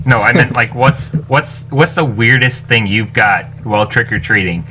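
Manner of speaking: ordinary talk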